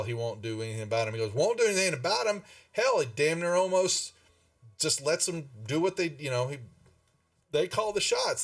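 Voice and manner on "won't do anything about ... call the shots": much higher, louder and fast, an incredulous angry echo; indignant outburst. Acted. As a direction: throw the question back in disbelief, then rant. Sildar echoes Toblin in outrage.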